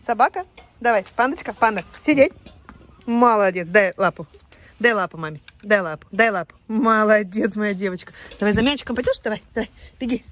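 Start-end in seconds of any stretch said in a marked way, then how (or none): 0.8-9.7 loud dog commands; firm "дай лапу" for the dog; talking to a dog. Real, not acted.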